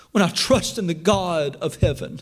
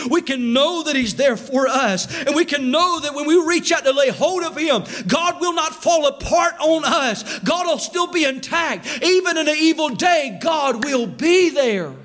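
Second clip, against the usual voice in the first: rising preaching climax; 5 semitones higher than usual. Acted. Preaching at full force.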